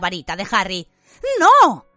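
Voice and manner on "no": loud, high "no" shout; exclamation. A narrator's reading. Harry stopping the cat going for his wand, sharp exclamation. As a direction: cry out in sudden protest. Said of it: "exclamó Harry".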